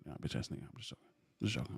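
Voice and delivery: very quiet, low mutter under the breath; half-whispered teasing aside; joking aside. Real, not acted. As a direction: toss it off almost inaudibly, kidding.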